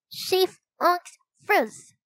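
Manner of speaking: put-on high, muffled little-kid words; mouth full. A narrator's reading. Four-year-old answering at the door, talking with a full mouth. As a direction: garble it through a mouthful. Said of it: "said with a full mouth".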